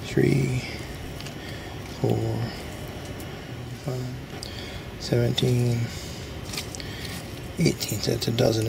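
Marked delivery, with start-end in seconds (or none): none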